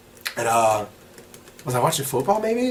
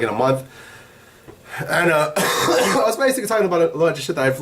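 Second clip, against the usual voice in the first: ordinary talk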